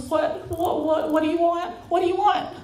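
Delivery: high, stammering, overawed question; blown away. Retold or imitated. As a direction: act out being too stunned to speak properly. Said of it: "you're just totally blown away".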